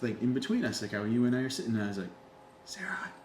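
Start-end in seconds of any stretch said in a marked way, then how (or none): 2.6-3.1 whispered to a friend, high and soft; re-enacted stage whisper; urgent hushed whisper. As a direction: get her attention without being overheard. Retelling hushed alert to friend.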